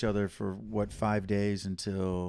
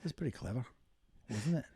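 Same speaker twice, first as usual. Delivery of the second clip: soft Scottish brogue; Scottish accent; 3 semitones higher, 6 dB quieter, faster than usual. Pretend. Host fishes for co-host's approval in soft Scottish. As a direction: show off a hushed Scottish accent. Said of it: "I can keep talking like this".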